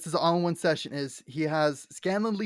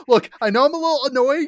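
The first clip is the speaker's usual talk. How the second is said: higher, louder, fast sheepish plea; mock Scanlan plea; 8 semitones higher, 8 dB louder, faster than usual. Scanlan admitting faults to the Sphinx, mock plea. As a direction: rush the apology like Scanlan. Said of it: "It's like".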